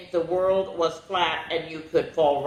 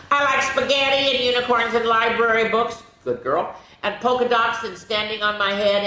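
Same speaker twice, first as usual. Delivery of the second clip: a little higher, lively bird chatter; chatty bird voice; 5 semitones higher, 4 dB louder than usual. Acted. Teacher reading the bird's likes, lightly voiced bird. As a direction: rattle off likes like an eager child. Reads picture book aloud.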